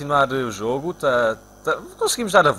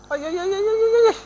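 much higher rising wail, louder at the end; anguished ai; 4 dB louder than usual. Real, not acted. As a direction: suspense breaking into a cry. Near miss in the game.

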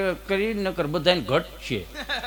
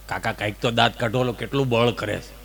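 low mumbled nephew voice, much softer; a poor nephew coaxing his stern uncle in a low murmur; nephew pleading; 10 semitones lower, 5 dB quieter than usual. Acted. Nephew begging uncle to smile, low and soft.

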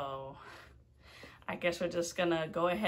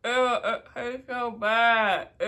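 slow, louder, drawn-out disgust; 3 semitones higher, 7 dB louder, slower than usual. Real, not acted. Vlogger tasting the hot chip, loud disgust. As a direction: groan it out in disgust.

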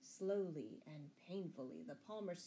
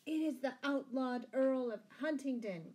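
loud, higher announcement; crying out; 6 semitones higher, 5 dB louder than usual. A narrator's reading. Sheriff exposing the outlaw to the crowd, crying out. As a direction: call it out to everyone. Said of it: "cried the sheriff".

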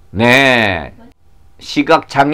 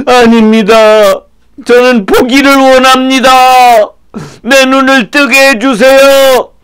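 high, urgent begging; earnest pleading; 8 semitones higher, 4 dB louder than usual. Acted. Blind man asking Jesus for sight, earnest pleading. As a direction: plead with all your might.

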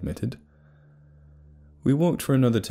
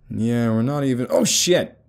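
tripping mid-sentence, alarmed; startled yelp; 4 semitones higher, 5 dB louder than usual. Acted. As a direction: talk cut off by a sudden yell.

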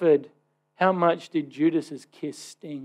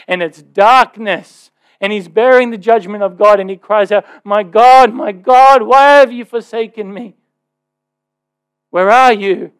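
crying out from the cross; 3 semitones higher, 11 dB louder than usual. Real, not acted. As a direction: cry it out with full anguish. Crying out Jesus' words.

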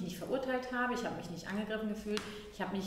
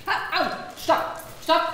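lautes Stopp; 8 semitones higher, 9 dB louder, slower than usual. Acted. Mutter stoppt werfendes Kind.

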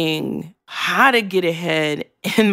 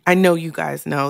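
ordinary talk

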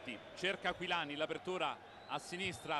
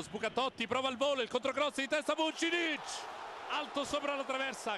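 breathless italian goal commentary; 5 semitones higher than usual. Real, not acted. Near miss in live commentary.

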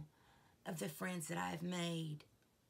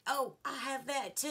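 bright, high, louder mock exclaim; excited quote; 7 semitones higher, 4 dB louder than usual. Telling of bonding with Jennifer, excited exclaim. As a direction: chirp it like finding a twin. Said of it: "it's like".

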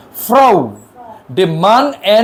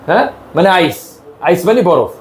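ordinary talk

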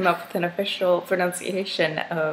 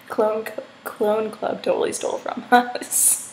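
ordinary talk

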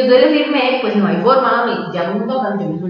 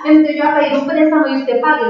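high, louder, indignant outburst; retold angry mother; 7 semitones higher, 4 dB louder than usual. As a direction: play an upset mother going off.

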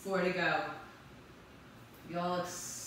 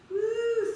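a sudden high, loud whoop to pump up the class; excited whoop; 13 semitones higher, 8 dB louder than usual. Real, not acted. Fitness coach whooping loudly to fire up her class. Coach cheering mid-workout.

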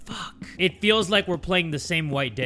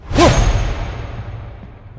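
breathy whoa; 11 semitones higher, 6 dB louder than usual. Real, not acted. Long whispered awe.

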